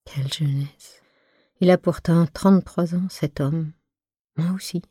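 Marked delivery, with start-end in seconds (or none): 0.1-1.0 whispered echo. A narrator's reading. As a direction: say Quelle jeunesse to yourself under your breath. Quiet echo after he leaves.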